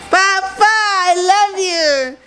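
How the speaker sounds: high, slow tender cooing. Real, not acted. Cooing to baby.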